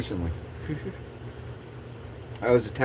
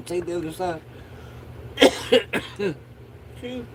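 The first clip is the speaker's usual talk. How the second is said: coughing fit between words; strained, higher voice through coughs; stoner; 7 semitones higher, much slower than usual. Real, not acted. Coughing fit while talking, smoking.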